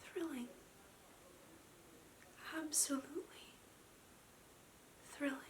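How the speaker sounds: hushed, entranced whisper; barely audible, spellbound whisper. Acted. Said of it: "[name] whispered".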